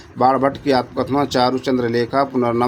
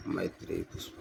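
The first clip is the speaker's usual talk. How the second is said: breathy whisper of the answer, very soft; 18 dB quieter, faster than usual. Shown as a demonstration. Teacher whispers the quiz answer to students, hushed. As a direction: whisper the answer like a hint to the class.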